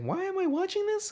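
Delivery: high, incredulous question; baffled disbelief. Retold or imitated. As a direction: ask it in shocked disbelief. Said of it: "I'm like".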